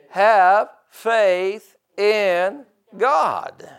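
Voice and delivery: loud, high, slowly measured proclamation; emphatic preaching. Real, not acted. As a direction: drive each word home to the congregation, with pauses. Preaches the verses at full force.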